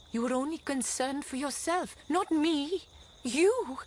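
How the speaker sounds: building to a loud, high "You!"; angry accusation. Acted. Wife accuses her husband of selfishness, rising to a loud outburst.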